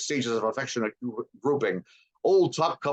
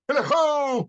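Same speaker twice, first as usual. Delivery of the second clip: high, loud whoop; a merry shout to start the fun; jolly cry; 5 dB louder than usual. A narrator's reading. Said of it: "cried old Fezziwig".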